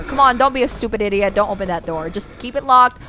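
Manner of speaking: usual speaking voice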